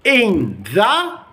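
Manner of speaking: ordinary delivery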